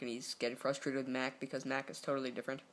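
normal talking voice

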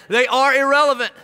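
loud, high declaration that they are irrelevant; emphatic shout. Real, not acted. Preacher to wives, shouting the point home. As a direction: belt the punchline out. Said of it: "preacher's emphatic point".

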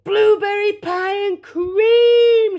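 high, loud, drawn-out cry of delight; delighted shout. A narrator's reading. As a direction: shout it out, stretching the words slowly. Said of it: "shouted Violet".

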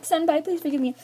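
high-pitched retold begging; mock pleading. Girl still asking the boy's forgiveness, mock pleading. Repeats her plea to the boy.

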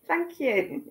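high, giggly thank-you; real chuckle in a high-pitched voice; laughing thanks. Real, not acted.

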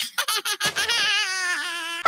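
long, low staccato cackle; scheming laugh. Acted. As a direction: cackle on and on over your plan. Esulta per il piano furbo.

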